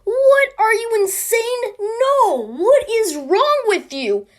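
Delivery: high, loud, drawn-out mock outrage; outraged. The South reacts to banning slavery, outraged. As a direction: shriek in hammed-up disbelief. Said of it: "The South was outraged".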